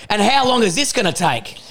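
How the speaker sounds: put-on high, impatient old country lady; mock a fed-up old country woman; annoyed old country woman. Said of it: "she was annoyed by".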